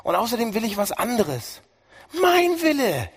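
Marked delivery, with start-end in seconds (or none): 2.2-3.1 defiant cry. Retold or imitated. Voicing rebellious human to God.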